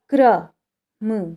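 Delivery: क्रम drawn out slowly, the र blended straight after क as kram; teacher slowly models क्रम for the class; slow pronunciation demo. Shown as a demonstration. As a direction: stretch क्रम so kra is heard as one cluster. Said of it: "क पे र लगा है नीचे".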